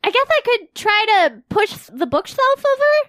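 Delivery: high, loud panicky voice; high panicked character. Acted. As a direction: panic over the burning room. Emmy arguing in panic.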